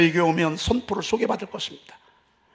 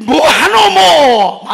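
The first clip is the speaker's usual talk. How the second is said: high, loud Gyeongsang shout; Gyeongsang dialect; 9 semitones higher, 14 dB louder, much slower than usual. Retold or imitated. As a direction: bark it out in broad Gyeongsang dialect. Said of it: "아마 이랬을 것입니다". (높고 크게 외치는 경상도 사투리)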